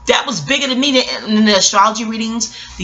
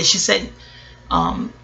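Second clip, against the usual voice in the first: ordinary talk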